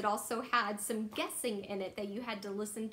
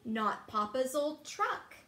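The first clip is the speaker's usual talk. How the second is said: usual speaking voice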